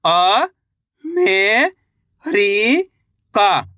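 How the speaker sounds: each syllable said apart with pauses, then joined. Shown as a demonstration. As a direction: sound out अ, मे, री, का slowly before the whole word America. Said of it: "एक बार फिर से देखिए".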